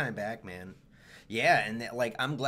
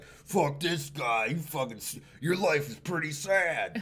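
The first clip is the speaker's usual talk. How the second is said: put-on slow drunk heckler; 4 semitones higher, much slower than usual.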